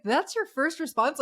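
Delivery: high, disbelieving question; incredulous. Retold or imitated. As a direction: sound amazed at the idea. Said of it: "I'm literally like".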